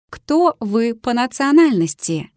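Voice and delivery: usual speaking voice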